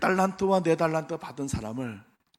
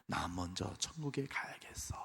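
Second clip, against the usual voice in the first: whispered farewell; 6 semitones lower, 14 dB quieter than usual. Retold or imitated. Preacher voicing a dying believer, whispering. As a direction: breathe it like a last word.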